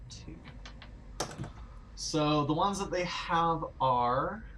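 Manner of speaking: usual speaking voice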